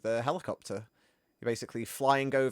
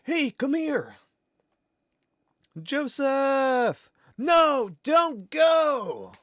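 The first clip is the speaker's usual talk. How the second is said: much higher and louder, mock desperate calls; hammed-up shouting; 11 semitones higher, 5 dB louder, much slower than usual. Quoting Joseph's cutscene to stream chat, hammed-up desperate shout. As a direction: shout like bad game voice acting. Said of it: "It's just when Joseph's like".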